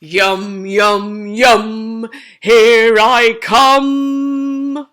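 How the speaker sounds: slow, loud greedy wolf; gleeful wolf. Acted. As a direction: savour every yum. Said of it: "laughed the wolf".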